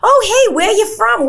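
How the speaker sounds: put-on a friendly Jersey lady calling over, New Jersey accent. Lady next to them asking where they're from, high New Jersey accent. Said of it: "the lady right next to us goes".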